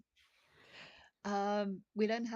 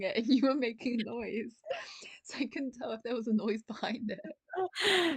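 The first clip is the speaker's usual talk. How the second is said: laughing whisper; 4 semitones higher, 7 dB louder than usual. Real, not acted. Giggling whispered aside.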